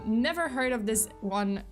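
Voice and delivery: usual speaking voice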